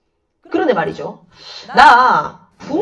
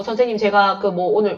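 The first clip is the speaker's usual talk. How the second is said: usual speaking voice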